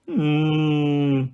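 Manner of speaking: long, level moo-like hum; closed-mouth cow moan; cow lowing. A narrator's reading. The cow creature lows uneasily again.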